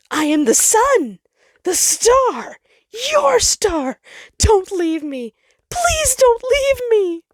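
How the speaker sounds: high, loud pleading, slowing at the end; cling and beg not to be left; a frightened star begging the planet to stay; desperate begging. Acted. Sol begs Jupiter in panic.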